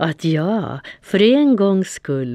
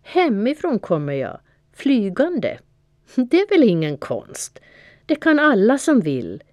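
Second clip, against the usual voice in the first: higher, bragging cat voice; proud boasting; 7 semitones higher than usual. A narrator's reading. Dylan brags to Fia about flying, high and boastful. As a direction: show off like it's nothing.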